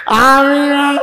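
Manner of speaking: high, loud drawn-out cry; exaggerated ringing response; loud acted amen. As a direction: belt it out, pitched high and stretched. Same amen repeated loudly.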